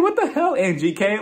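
high-pitched mock disbelief; shocked. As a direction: squeal it in disbelief at the TV. Recapper voicing own shocked reaction.

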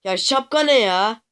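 loud, high exasperated shouting at the game; real outburst over a bad prize; yelling in real frustration; frustrated yelling. Real, not acted. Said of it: "şurada bağırttırmayın ya".

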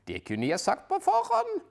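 dry know-it-all character; detective grumbling to his wife about the race; detective's voice. Acted. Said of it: "sier Puriot".

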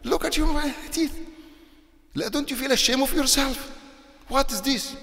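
high-pitched, scornful scolding of a smoker; mock them in a shrill high voice, ashamed-of-you tone. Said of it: "some they say".

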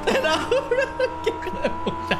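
squeaky laughing. Real, not acted. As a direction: laugh through the words. Laughing at friend.

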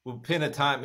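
normal talking voice

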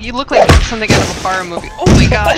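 loud, fast startled yell; startled shout. Real, not acted. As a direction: blurt it out in shock. Shouting at horror scene.

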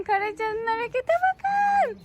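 high and slow, stretching the words; drawn-out excited cheer. Real, not acted. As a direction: savour the cheer slowly.

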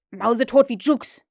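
loud, brusque answer; gruff. A narrator's reading. Captain answers question about missing pirate, gruff. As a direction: throw it out harshly. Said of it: "sagte Huck barsch".

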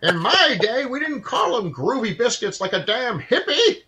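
high, laughing cranky geezer; grumpy old man voice. Retold or imitated. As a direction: grumble like a crotchety old man. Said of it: "old man voice reply".

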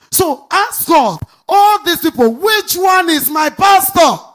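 high, loud, slow cry; preaching shout. Acted. Pastor calling on the congregation to ask God, shouting high and slow. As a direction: cry it out slowly to the rafters.

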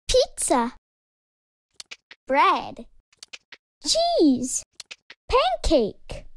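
plain reading voice

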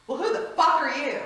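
loud, high, challenging bark at a stranger; gruff shout. Retold or imitated. Patient greeting the chaplain with a loud, rude challenge. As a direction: yell it like you own the room.

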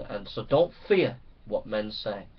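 ordinary talk

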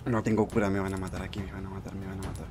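low, fading to very quiet; resigned muttering. Real, not acted. Player without healing expects death, resigned muttering. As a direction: mumble it hopelessly, sinking away.

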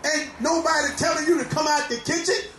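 full-force preaching, shouting over the organ. Real, not acted. Preacher exhorting congregation.

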